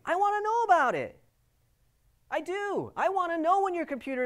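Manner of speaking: loud, high insistence on know; emphatic. Real, not acted. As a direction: hammer home that you want to know. Emphatic plea to users.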